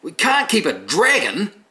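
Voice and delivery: a prim broom refusing outright; uptight broomstick. Retold or imitated. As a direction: broomstick objecting to the witch, high, loud and slow. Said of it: "very uptight, against everything".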